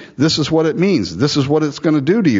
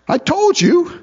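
whiny I-told-you; slower than usual. Retold or imitated. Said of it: "king complaining to Jehoshaphat".

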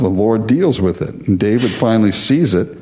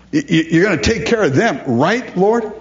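high, pleading whine, seeking agreement; whiny plea; 8 semitones higher than usual. Retold or imitated. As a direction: wheedle for God to agree. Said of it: "we're saying".

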